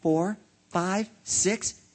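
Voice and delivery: high count climbing in pitch; astonished counting. A narrator's reading. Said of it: "Bartholomew kept counting".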